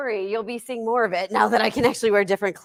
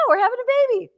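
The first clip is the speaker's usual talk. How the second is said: much higher, louder, thrilled; excited announcement; 5 dB louder, much faster than usual. Real, not acted. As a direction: announce joyful news in a high, excited lift. Announcing her pregnancy on air.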